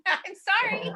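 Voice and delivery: high, laughing sorry; mock apology. Forgiveness laughter, apologising with a laugh.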